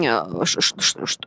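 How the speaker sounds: crackling distorted audio; fuzz distortion. Real, not acted. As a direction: voice breaking up into buzzing static. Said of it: "что-то у меня звук трещит". (треск и искажение звука в эфире)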